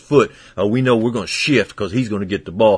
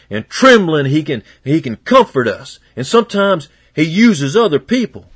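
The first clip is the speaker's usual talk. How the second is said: louder, higher rising praise; full-voiced revival preaching; fervent preaching; 6 semitones higher, 5 dB louder than usual. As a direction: preach it with building fervor. Preaching builds.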